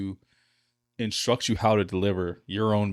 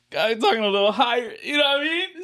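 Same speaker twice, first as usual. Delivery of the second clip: much higher and louder, climbing up; 6 dB louder than usual. Shown as a demonstration. Showing the cohost how high his cheerful voice goes. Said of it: "See how high we can get".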